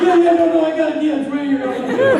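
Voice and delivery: high, loud flustered friend; hammed-up panicky phone voice; mock frantic. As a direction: blurt it in a high comic panic.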